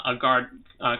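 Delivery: usual speaking voice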